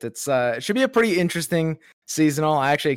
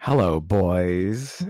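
low drawn-out greeting; 9 semitones lower, much slower than usual. Pretend. Greeting the hosts in a put-on voice.

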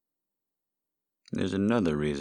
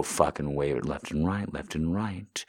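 normal talking voice

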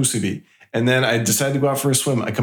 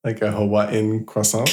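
Hawaiian-French croissant; 3 semitones lower than usual. Shown as a demonstration. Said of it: "Aaron steals accents".

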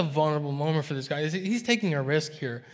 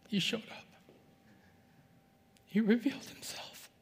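higher and much softer, reverent; hushed awe; 5 semitones higher, 8 dB quieter than usual. Real, not acted. Preacher sharing God's personal touch, hushed reverent awe. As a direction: let it land in quiet wonder. Sermon's emotional climax.

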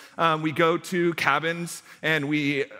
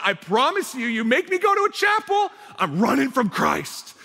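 mock kid outburst; 5 semitones higher than usual. Pastor imitating a kid refusing chapel, mock shout. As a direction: ham up a middle schooler's dramatic protest. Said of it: "I have one kid going".

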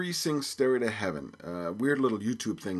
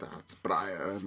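put-on half-hearted Irish accent; 3 semitones lower, 5 dB quieter, slower than usual. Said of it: "I should do my Irish accent".

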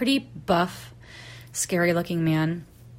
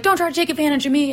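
higher, louder, rapid retold comeback; indignant echo; 8 semitones higher, 5 dB louder, much faster than usual. Rake guy throwing her warning back, indignant. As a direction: throw the accusation back, acted out. Acted haggling quarrel.

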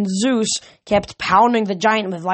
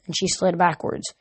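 plain reading voice

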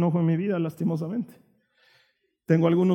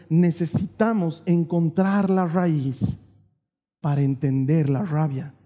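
slow, emphatic; 5 semitones lower, slower than usual. Acted. Preaching point.

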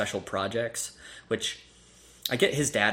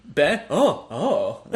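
mock sleepy gasp; 4 semitones higher, 4 dB louder, much slower than usual. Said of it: "she goes".

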